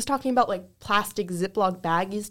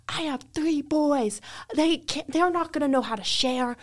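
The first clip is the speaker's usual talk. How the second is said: higher, whiny imitation of an insistent customer; mock demanding grandma; 7 semitones higher than usual. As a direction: mimic the pushy grandma. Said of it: "so mad at me".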